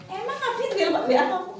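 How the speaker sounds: high, loud, quick imitation of envy; envious challenge. Envious friend questioning who messaged whom, mock envious challenge. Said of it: "Umi itu iri".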